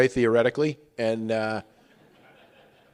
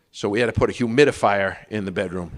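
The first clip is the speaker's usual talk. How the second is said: ordinary talk, nothing marked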